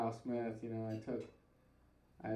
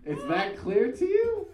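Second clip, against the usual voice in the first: surprised; 7 semitones higher, 6 dB louder than usual. Retold or imitated. Stunned at Kyle's quick verdict.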